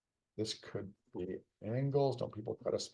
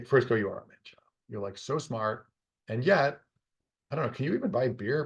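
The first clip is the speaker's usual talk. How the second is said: ordinary delivery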